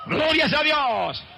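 high, slow, drawn-out praise cry; soaring slow shout of praise; preaching exclamation. Acted. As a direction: lift the praise up high and hold it.

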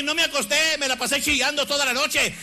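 ordinary talk, nothing marked

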